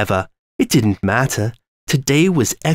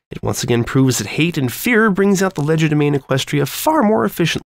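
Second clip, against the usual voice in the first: high, fast, mocking scholar's voice; villainous Twilight voice; 3 semitones higher, faster than usual. Twilight gloating to hypnotized Sunset, high and fast. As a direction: rattle it off high and quick, gloating.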